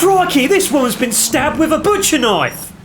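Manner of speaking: Crikey Aussie impression. Friend imitates the Australian detective finding a body in a high, excited Aussie accent. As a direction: gush like a thrilled Aussie wildlife host. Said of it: "Steve Owen is a detective".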